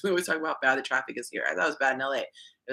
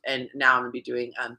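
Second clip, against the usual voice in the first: ordinary delivery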